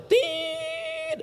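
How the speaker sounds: one very high, long, steady "Tit"; long flatline beep. Retold or imitated. Preacher imitates a flatlining monitor with a long, very high "Tit". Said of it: "Kalau titik itu panjang dia".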